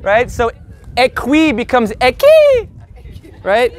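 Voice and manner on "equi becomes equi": English "ekwi" turned into squealed "eh-keee"; dolphin "ee". Shown as a demonstration. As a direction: make the last equi a dolphin squeal. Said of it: "the I is an orgasmic dolphin".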